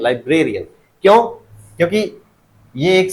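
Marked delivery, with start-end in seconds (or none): 0.0-0.6 "librarian" with ब्रे stressed; stress demo. Shown as a demonstration. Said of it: "क्या बोलेंगे?". ("Librarian" में ब्रे पर ज़ोर)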